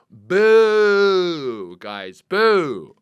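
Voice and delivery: drawn-out jokey boo; mock booing. Real, not acted. Complaining to viewers about tariff updates, jokey booing. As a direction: boo the boring news. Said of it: "booing tariffs".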